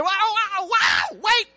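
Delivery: high, shouted "wow, wow, wow"; passersby gaping at the church sign; mock amazement. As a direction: act stunned by the sign. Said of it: "They say".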